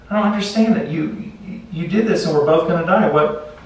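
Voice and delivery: high, bewildered pleading as life fades; dying disbelief. Acted. Dying frog asking the scorpion why, stunned and pleading.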